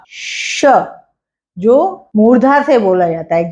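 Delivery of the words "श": श as a soft breathy hiss with no voice; voiceless sh demo. Shown as a demonstration. Teacher showing students the palatal sound, hushed demo. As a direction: breathe out श alone, tongue mid-palate. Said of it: "वो बोलते हैं श".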